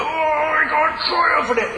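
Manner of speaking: straining at a jar. Retold or imitated. Straining at a stuck jam jar.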